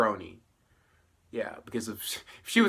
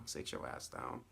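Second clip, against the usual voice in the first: breathy whisper, much softer; whispered scold; 12 semitones lower, 17 dB quieter than usual. Retold or imitated. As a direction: hiss the put-down to yourself. Repeating the scold.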